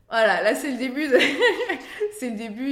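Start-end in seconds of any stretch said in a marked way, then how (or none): 0.1-1.2 words bursting out through a laugh, louder; amused, laughing admission. Real, not acted. Laughing at her own bad choice. 1.2-2.1 loud, high laugh bursting out; real loud high-pitched laugh; burst of laughter. Real, not acted. Laughs at her own bad choice.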